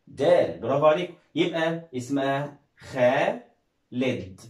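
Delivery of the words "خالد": خالد stretched slowly into خا and لد; syllable by syllable. Shown as a demonstration. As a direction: sound out خالد in two long, separate pieces. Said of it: "نشبكهم كلهم مع بعض".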